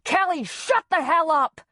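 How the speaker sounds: high, loud angry outburst; furious shout. A narrator's reading. Father interrupting apology to yell at daughter, furious shout. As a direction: explode at the child mid-apology. Father yelling at crying child.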